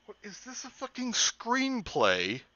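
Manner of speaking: high, slow, disbelieving; incredulous. Real, not acted. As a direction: ask it in total disbelief. Incredulous at the text.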